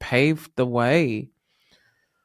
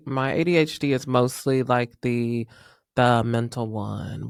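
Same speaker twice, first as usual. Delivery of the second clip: ordinary talk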